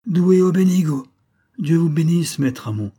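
crié de loin, plus haut et plus fort, moqueur; cri narquois. A narrator's reading. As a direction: narguer Hamon depuis le navire. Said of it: "cria du navire et d'un ton narquois". (le gars nargue Hamon en criant du bord)

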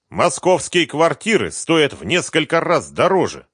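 high, loud angry remark; angrily. Acted. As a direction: snap it with anger. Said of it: "зло заметил Виктор Диланов".

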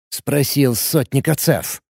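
plain reading voice

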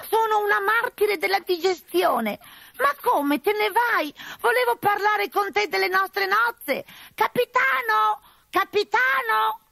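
high, slow falsetto calling after him; high falsetto bride calling. Acted. Fake bride calling after the fleeing captain, high and plaintive. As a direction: call the fleeing captain back, sweetly.

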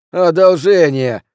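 loud, high, drawn-out scornful repeat; indignant, stretched-out exclamation; spitting the word out. A narrator's reading. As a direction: throw the word back, slow and loud. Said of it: "едва ли не выплюнул это слово".

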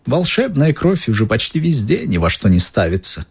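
high, oily, unctuous shopkeeper; smarmy and greasy, ingratiating. A narrator's reading. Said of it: "своим сальным голосом".

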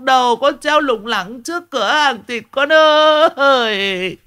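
very high wailing with drawn-out calls; keen for the lost one, stretching the endings; hammed-up funeral-style wail; plaintive lament. A narrator's reading. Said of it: "mụ chuyển sang tông giọng ai oán".

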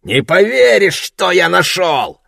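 put-on high, loud drunken shout. A narrator's reading. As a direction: yell it to a buddy. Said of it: "крикнул приятелю".

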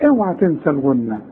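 ordinary talk

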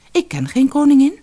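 baffled protest, jumping high and loud; astonished. A narrator's reading. Tao answers the stranger about a queen, astonished. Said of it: "herhaalde Tao verbaasd".

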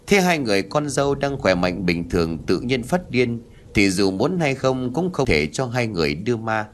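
usual speaking voice

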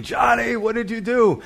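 high-pitched mock yell at normal volume; retold scream. Uncle yelling at fighting kids, retold scream. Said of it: "and I scream".

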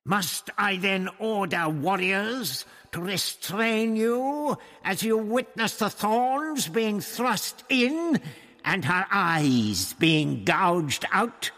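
high, slow, drawn-out villain's threat; snarling threat. A narrator's reading. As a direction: snarl it slowly, climbing in pitch. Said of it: "he snarled".

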